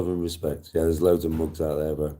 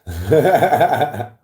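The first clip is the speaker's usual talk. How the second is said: loud laugh; 7 semitones higher, 7 dB louder than usual. Real, not acted. Friend laughs aloud.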